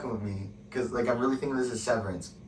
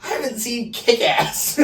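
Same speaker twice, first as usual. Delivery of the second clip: laughing; 12 semitones higher, 8 dB louder, slower than usual. Real, not acted. Admitting while cracking up.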